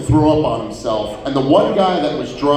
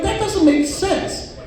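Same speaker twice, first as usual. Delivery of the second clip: mock baffled; 3 dB quieter, slower than usual. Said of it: "And I'm like".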